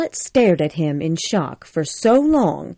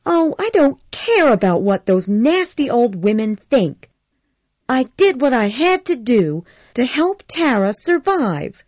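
put-on higher, indignant young woman, mildly angry; angry Scarlett; 7 semitones higher, 3 dB louder than usual. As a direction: Scarlett defending herself with a touch of anger. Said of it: "said Scarlett angrily".

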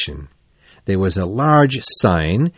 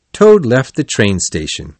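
ordinary delivery

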